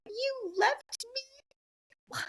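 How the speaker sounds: put-on very high, drawn-out wail of a hurt boy; crying son. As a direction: stretch it out like a wronged kid. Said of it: "and he's like".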